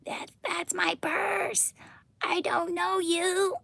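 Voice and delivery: high, slow whispered whine; Bobby Hill voice. Pretend. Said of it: "a female voiced Bobby".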